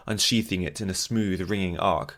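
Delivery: plain reading voice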